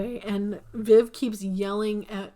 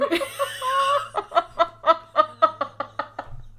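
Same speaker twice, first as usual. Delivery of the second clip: long laugh; 9 semitones higher, 4 dB louder than usual. Real, not acted. Helpless laughter at story.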